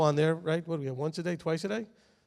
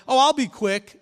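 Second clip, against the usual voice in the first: higher, louder quote of a teacher; mock promise; 7 semitones higher, 7 dB louder than usual. Teachers fighting for microphone time, mock promise. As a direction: lightly mimic a teacher butting in.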